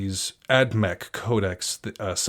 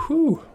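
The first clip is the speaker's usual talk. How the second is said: sharp high whistling whew; 5 dB louder than usual. Real, not acted. Reacting to codex rules.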